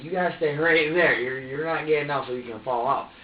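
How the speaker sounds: exasperated, imitated command; firm scolding. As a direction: insist forcefully, as if telling the baby off. Retold scolding of the baby.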